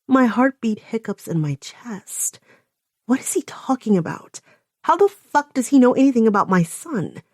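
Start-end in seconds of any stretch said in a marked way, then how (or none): none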